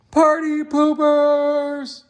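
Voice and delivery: high, slow, mocking pout; sulky jeer. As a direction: sneer at the guests as they go. Fish sulks as guests leave.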